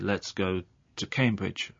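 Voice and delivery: "to" shrunk to a quick tuh before Cambridge; weak "to". Shown as a demonstration. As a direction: barely sound "to", just t and schwa. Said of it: "it just sounds like t".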